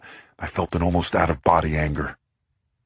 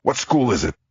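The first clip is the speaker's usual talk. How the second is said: cried out; 8 semitones higher, 3 dB louder than usual. A narrator's reading. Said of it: "I cried".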